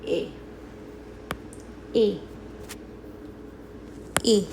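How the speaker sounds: A as a short, pure ay; isolated A, repeated for learners; French é sound. Shown as a demonstration. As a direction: model A alone, a short ay with pauses between. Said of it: "it sounds like".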